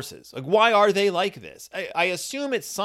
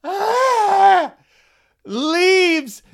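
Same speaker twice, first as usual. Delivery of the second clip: high, loud mock-panic shriek; panicked horse shriek; 9 dB louder than usual. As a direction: shriek in fake terror at the leaves. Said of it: "Every single horse is like".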